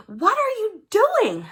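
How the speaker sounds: high, slow shouted question; a child shouting high from the window. Acted. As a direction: call out high and drawn out through the window. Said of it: "I shouted".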